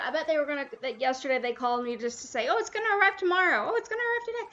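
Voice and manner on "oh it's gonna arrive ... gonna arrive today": high, sing-song mockery of callers; mocking quote. Streamer mimicking callers' pointless delivery updates, mocking. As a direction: parrot them in a higher, fussy voice.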